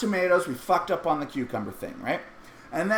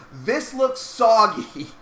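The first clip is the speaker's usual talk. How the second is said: slow unimpressed; 4 semitones higher, 4 dB louder, much slower than usual. Retold or imitated. Daughter inspects sandwich.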